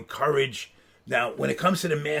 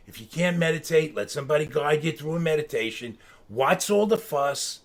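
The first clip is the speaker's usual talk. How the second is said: normal talking voice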